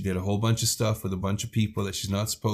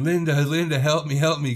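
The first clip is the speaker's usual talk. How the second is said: milder imitated scream, raised pitch; mock cry for help, higher than usual talk; Handy; 5 semitones higher, 3 dB louder than usual. Niece held down calling for her other aunt, mild imitated screaming. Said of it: "And she's screaming".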